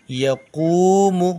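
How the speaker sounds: या कूमू with या quick and कूमू drawn out; slow recitation drill; short या, long कूमू. Shown as a demonstration. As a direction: clip या, stretch कूमू. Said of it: "या को जल्दी पढ़ेंगे".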